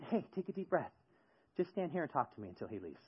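soft, retold words of comfort; calm reassurance. Man drawing a berated barista aside, softly reassuring. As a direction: settle her down quietly.

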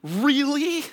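put-on high, slow child's voice stretching out the name, laughing on really; a kid mocking the knockoff brand; dad imitating his daughter's disbelief at the bottle; incredulous little girl. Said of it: "She was like".

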